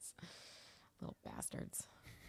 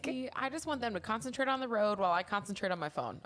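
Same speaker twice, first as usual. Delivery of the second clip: ordinary talk, nothing marked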